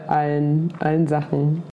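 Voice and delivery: usual speaking voice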